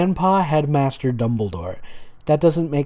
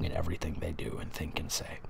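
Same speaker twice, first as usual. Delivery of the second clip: sudden unvoiced whisper, much softer, fast; sarcastic whisper; 9 semitones lower, 15 dB quieter, much faster than usual. Real, not acted. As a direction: hiss the end of the rant under your breath, fast. Sarcastic rant trails into whisper.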